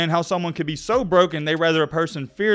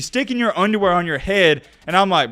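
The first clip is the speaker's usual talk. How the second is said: normal talking voice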